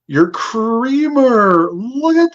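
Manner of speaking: high, slow, squealing delight; delighted gush. Real, not acted. Seller gushing to her viewers over the strawberry creamer, high and drawn out.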